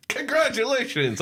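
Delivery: high-pitched, grudging mock congratulations; pissed congratulations. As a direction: imitate congratulating someone while still annoyed. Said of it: "she's kind of pissed still".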